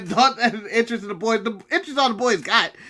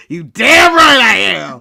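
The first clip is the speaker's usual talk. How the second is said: slow, louder, swaggering; slow tough-guy voice; 8 dB louder, slower than usual. Retold or imitated. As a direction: drawl it like a cocky heel. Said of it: "he goes".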